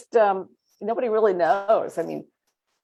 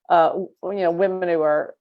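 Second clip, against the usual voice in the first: normal talking voice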